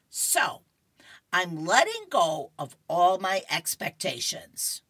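loud, emphatic cartoon resolve; hammed-up proclamation; declaring comic character. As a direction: announce it grandly, punching the key words. Voicing Opal declaring in comic.

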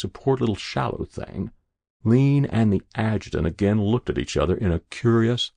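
ordinary delivery, nothing marked

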